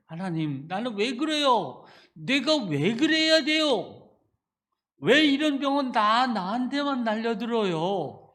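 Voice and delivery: complaining prayer. Retold or imitated. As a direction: cry out to God in protest. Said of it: "그렇게 하면서".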